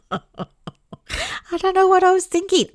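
high, slow words through a laugh; laughing at herself. Real, not acted. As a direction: laugh it out at your own silliness. Laughing at past self.

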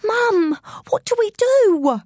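very high, urgent plea; panicking. Acted. Child begging Mum as the door opens, panicking. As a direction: cry out for help in panic. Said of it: "I was panicking now".

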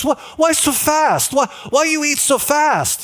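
high, imitated exasperated questioning; bewildered partner. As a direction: imitate a friend demanding an explanation. Acts out partner's bewildered protest.

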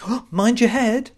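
high, loud belated warning; mock alarmed warning. Driver reacting as passenger bumps their head, mock alarm. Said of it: "you come out with".

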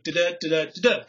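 "da-da" chanted in a steady da-DA rhythm; iambic beat. Shown as a demonstration. To the group, chanting the da-DA beat of pentameter. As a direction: tap out each "da-da" like a metronome. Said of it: "the science of pentameter where it's like".

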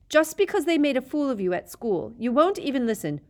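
put-on loud, high angry boy; angry yelling. A narrator's reading. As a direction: shout it back furiously. Harry yelling.

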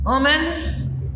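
"omen" said oh-men, meaning woman; Gullah "omen". Shown as a demonstration. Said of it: "I'm gonna use the word".

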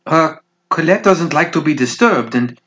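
put-on high, hesitant young woman; nervous girl voice. Nervous young dancer interrupting.

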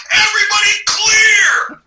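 full-out imitated yell, high and drawn out; yelled warning. Safety man warning the crew before explosions, full yell. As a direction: bellow it long and high, clearing the set. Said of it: "And then he yells".

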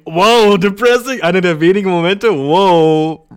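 loud, exaggerated wow; mock dismay. Streamer hearing his own sad remark, loud mock dismay. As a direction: blurt it loudly, mocking yourself.

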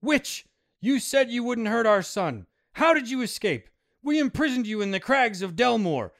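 high, loud and furious; accusing. Acted. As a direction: confront her with rage. Father confronts the witch.

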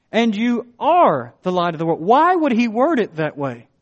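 fervent sermon voice; preaching. Acted. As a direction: press the point home. Preacher at full force.

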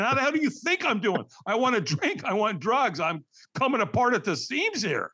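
high-pitched, worked-up retort; exasperated desperation. Retold or imitated. Newly sober man to morning caller, exasperated. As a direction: throw it back at a cheerful caller, frantic.

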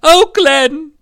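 high, loud acted wail; tearful mom. Mom leaving Glenn behind, acted crying. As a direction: wail his name as the mother. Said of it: "his mom was crying".

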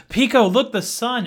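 high, loud, excited call; excited mock cry. Columbus excitedly points out the sun to Pico, mock excited cry. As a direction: act out an eager, oblivious shout.

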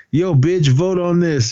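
high-pitched imitated jeer; mock taunt. Steele to the woman voting him out, taunting. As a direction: toss it out high, mock defiant. Retold taunt, corrected wording.

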